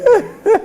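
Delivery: sudden high-pitched laugh. Real, not acted. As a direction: burst out laughing. Reacts to the banter.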